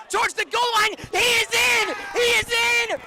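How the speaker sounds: yelled high and loud, building; touchdown call. Real, not acted. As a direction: scream the go-ahead score.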